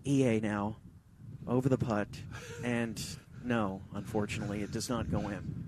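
whisper-quiet tension over the putt; golf commentator. Acted.

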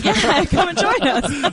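laughing invite. Real, not acted.

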